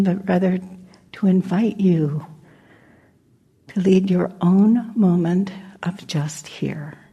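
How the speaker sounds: usual speaking voice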